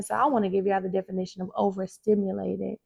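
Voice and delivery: ordinary talk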